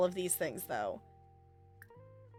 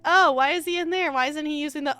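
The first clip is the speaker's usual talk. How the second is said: higher, louder retold bewilderment; puzzled mock outburst, raised in pitch; acting out puzzlement; 5 semitones higher, 7 dB louder than usual. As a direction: replay your own baffled reaction. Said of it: "and I'm like".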